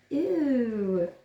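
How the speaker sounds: disgusted ew. Acted. Chewed ball disgust.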